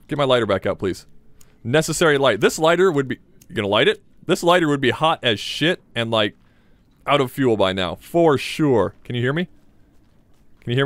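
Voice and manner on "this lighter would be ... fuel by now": usual speaking voice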